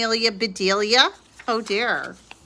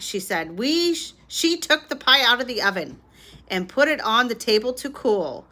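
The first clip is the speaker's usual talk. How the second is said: ordinary delivery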